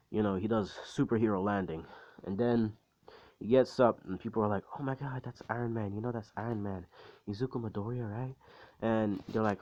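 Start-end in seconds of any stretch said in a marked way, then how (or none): 4.8-8.4 hushed, softer crowd chatter; onlookers whispering. Retold or imitated. Onlookers spotting Iron Man, retold in hushed gossip. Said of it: "everybody's, like, whispering and".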